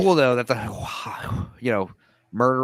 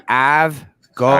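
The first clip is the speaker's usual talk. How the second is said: put-on American accent; 8 dB louder than usual. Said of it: "your one American accent part".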